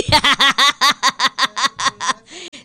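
long, high, loud cackling fit; real laughter. Real, not acted. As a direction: laugh helplessly in a long run. Le da risa la confusión. (carcajada larga, aguda y fuerte)

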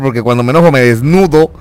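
usual speaking voice

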